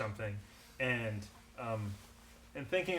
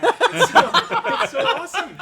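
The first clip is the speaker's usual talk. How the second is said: ecstatic shout; 17 dB louder, slower than usual. Acted. Cured Darius shouts with joy.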